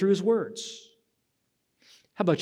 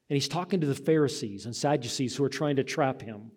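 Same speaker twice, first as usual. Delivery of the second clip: ordinary delivery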